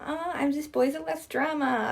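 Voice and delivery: put-on giggly pick-me girl. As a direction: sweetly play the not-like-other-girls girl. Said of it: "I'm sounding a bit like a pick-me girl now".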